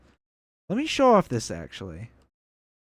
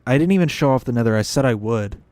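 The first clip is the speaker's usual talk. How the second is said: louder, fast, suddenly remembering; excited; 11 dB louder, faster than usual. Real, not acted. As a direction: blurt it out, realising it was forgotten. Suddenly remembers forgotten Nether.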